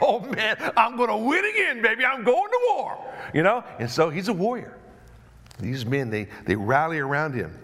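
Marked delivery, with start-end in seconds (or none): 0.0-3.0 laughing, high war whoop; gleeful and pumped, ready to fight; David thrilled by God's answer, laughing and high; excited David. Acted. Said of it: "And David's like".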